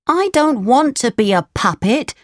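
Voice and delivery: put-on high, childlike puppet voice; high-pitched storybook puppet; protesting puppet. A narrator's reading. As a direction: complain in a high little voice.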